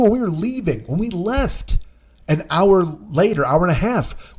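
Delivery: usual speaking voice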